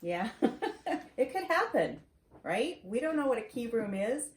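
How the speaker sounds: plain reading voice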